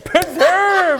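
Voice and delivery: high-pitched mock baby cry; imitated baby. Co-host imitating the film's baby, high mock demand. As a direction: call it out high, like an overgrown baby demanding food. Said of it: "the baby was. It's like".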